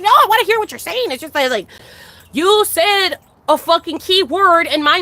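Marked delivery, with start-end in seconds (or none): 0.0-1.1 higher-pitched, insistent quoted protest; exasperated retold self-defense; protesting reply. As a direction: act out pleading your case, pitched up. Acting out own protesting reply.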